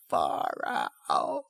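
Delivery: delighted cooed far out; cooing. Retold or imitated. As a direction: coo it, pleased and dreamy. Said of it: "the dude coos".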